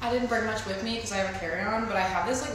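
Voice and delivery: quiet vlog talk; hushed voice. Real, not acted.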